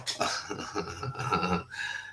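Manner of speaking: soft, breathy laugh; real laugh. Real, not acted. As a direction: laugh softly to yourself. Laughing at talk of levelling Israel.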